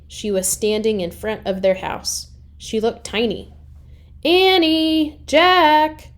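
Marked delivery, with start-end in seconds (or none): 4.2-6.1 high, loud calling names. Acted. Mom calls the children home. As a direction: call them home from the house. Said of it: "she called".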